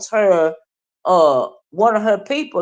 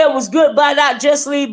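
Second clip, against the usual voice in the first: ordinary talk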